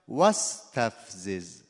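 واستفزز slow, with the first syllable وا stressed. Shown as a demonstration. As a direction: hit the opening وا of واستفزز. (تکیه روی بخش اول واستفزز)

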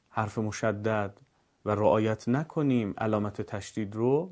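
usual speaking voice

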